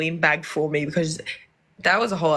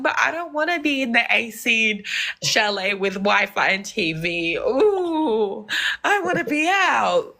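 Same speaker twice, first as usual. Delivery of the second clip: mock whining; 3 semitones higher than usual. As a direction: whinge like a spoiled kid, playfully. Acting out her complaint at being locked in.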